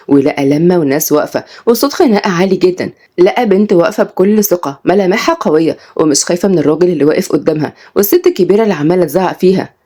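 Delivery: usual speaking voice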